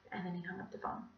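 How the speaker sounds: plain reading voice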